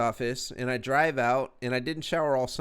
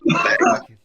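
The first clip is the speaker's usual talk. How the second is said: sudden high yelled outburst; squealed high shout; playful shout; 11 dB louder than usual. Acted. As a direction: yell it out at a buddy in mock awe. Mock shout at friend's look.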